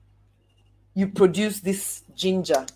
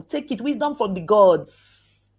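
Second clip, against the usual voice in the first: normal talking voice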